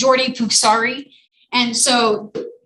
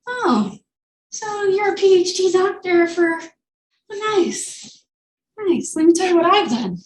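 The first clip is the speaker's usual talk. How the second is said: mock impressed; 7 semitones higher than usual. Mocking a snooty PhD reply.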